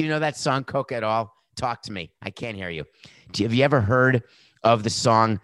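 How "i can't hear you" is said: usual speaking voice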